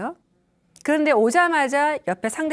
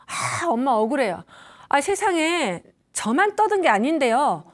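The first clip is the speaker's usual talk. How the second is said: put-on child's whiny protest, louder and higher to a tearful break; aggrieved child; 4 semitones higher, 6 dB louder than usual. Child from school telling mom it's unfair, whining tearfully. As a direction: complain to mom it isn't fair, near tears. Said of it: "예를 들어서 학교에서 애가".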